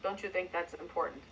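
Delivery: low, soft and lifeless; mumbled, dull question; bored, deflated. Shown as a demonstration. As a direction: ask it with zero conviction. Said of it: "So you can't say".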